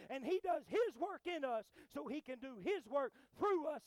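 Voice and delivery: loud, high, hard-driven sermon delivery; impassioned pulpit shout; full-force preaching. Real, not acted. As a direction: preach it hard at the congregation, building phrase on phrase.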